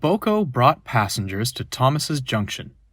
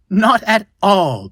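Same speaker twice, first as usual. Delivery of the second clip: higher, louder, slowed and delighted; beaming; 8 semitones higher, 6 dB louder, slower than usual. A narrator's reading. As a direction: beam with relief at the help. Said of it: "beamed Edward".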